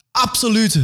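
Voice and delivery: high crowd cry; crowd cheering. Acted. The massa answers the leader, high cry. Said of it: "roept de massa".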